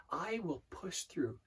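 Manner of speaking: slow, soft repetition to oneself; thoughtful mantra. Shown as a demonstration. Coach thinking through an affirmation, slow and soft. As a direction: let each phrase sink in, quietly. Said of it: "as you're thinking through".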